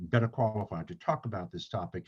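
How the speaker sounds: ordinary talk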